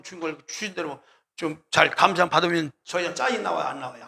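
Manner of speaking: ordinary talk, nothing marked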